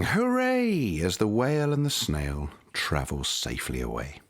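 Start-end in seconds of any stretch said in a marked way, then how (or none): 0.0-1.0 a high, cheering hooray. A narrator's reading. Villagers cheering the whale away, a high cheer. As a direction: cheer like a crowd. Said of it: "villagers shouting".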